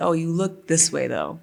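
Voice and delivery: usual speaking voice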